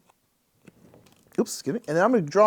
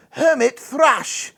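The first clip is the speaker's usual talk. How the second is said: high, drawn-out mock ornithologist; weird ornithologist voice; 8 semitones higher, 4 dB louder, much slower than usual. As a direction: say the bird name in a silly, slow scholarly voice. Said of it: "doing that weird voice and accent".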